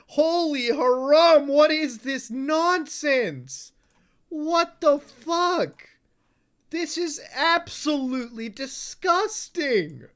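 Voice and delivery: hammed-up appalled slow rant; drawn-out mock disgust. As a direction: stretch every word in theatrical disbelief.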